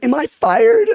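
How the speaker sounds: mock panic. Asking her job if she is fired, panicked. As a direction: squeak it out like you fear the sack. Retelling panicked question to boss.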